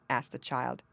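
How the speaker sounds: ordinary delivery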